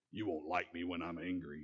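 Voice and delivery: low menacing monster rumble; a hint of the Hulk, deep and quiet; Hulk quote. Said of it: "If you're familiar with the Incredible Hulk".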